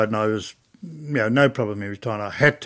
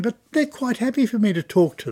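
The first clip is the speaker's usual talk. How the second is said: chirpy protest; 11 semitones higher than usual. Retold or imitated. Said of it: "you know, like that".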